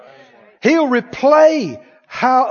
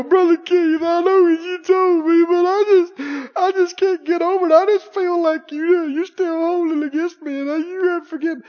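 higher, tearful whine that can't let go; mock crying plea; 8 semitones higher than usual. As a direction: beg the pastor again, voice breaking. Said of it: "crying through my whole message".

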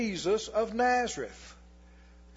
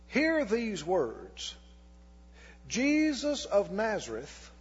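slow, deliberate Bible reading with weighty pauses; preacher reading Acts aloud to the congregation, slow and solemn; scripture reading. Acted. Preacher reading scripture to church, measured and slow.

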